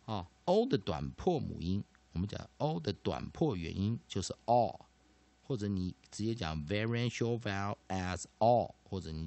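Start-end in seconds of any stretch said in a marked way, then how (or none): none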